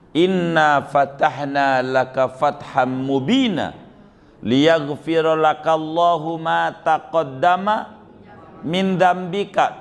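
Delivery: slow, melodic Quran chanting; Quran recitation. Acted. (bacaan Quran dilantunkan pelan)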